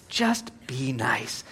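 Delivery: slow, soft whisper; hushed plea. Shown as a demonstration. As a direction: whisper it slowly like a gentle reminder. Softly repeats the point.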